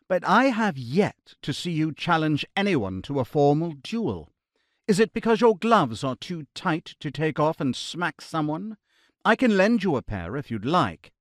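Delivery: put-on crisp, strong British accent, dryly teasing; lightly mock the Irishman in a strong British accent; clear British accent. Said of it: "his British accent clear and strong".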